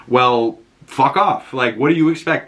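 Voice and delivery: ordinary delivery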